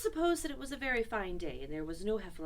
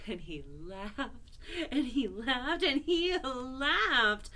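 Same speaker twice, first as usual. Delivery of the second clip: rising higher and louder with each "laughed"; building repetition; 5 semitones higher, 4 dB louder, much slower than usual. A narrator's reading. Narrator tells of Christopher Robin's laughter, building louder. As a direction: stretch "laughed" longer and bigger each time.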